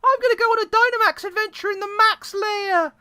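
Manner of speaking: put-on high upbeat teen girl; excited girl voice. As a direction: chirp it out eagerly. Game character line, high voice.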